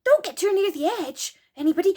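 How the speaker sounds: ordinary delivery, nothing marked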